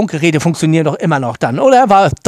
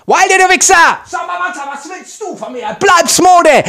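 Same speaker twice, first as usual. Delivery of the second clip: furious shout; 11 semitones higher than usual. Acted. Screaming at the priest Wilde.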